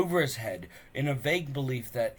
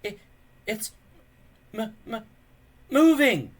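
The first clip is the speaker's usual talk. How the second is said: high, loud, stuttering in fright; a boy stammering at a corpse that moves; terrified stutter; 9 semitones higher, 9 dB louder than usual. A narrator's reading. Hiccup stammers in fear at the corpse, high and loud. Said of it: "stuttered Hiccup".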